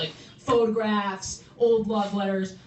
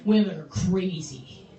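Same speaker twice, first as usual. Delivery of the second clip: low, dopey bro voice, mocking; mocking dude voice; 9 semitones lower than usual. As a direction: lower the voice into a dismissive dude. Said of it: "some dudes will be like".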